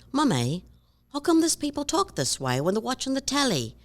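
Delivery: high-pitched child imitating British TV; little kid's mock British accent. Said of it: "watching a British show".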